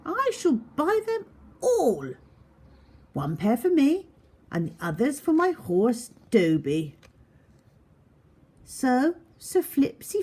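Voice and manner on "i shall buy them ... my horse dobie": high, pompous knight greeting the shoemakers; grand knight voice. A narrator's reading. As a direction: puff up like a showy nobleman, much higher. Knight buys all the shoes.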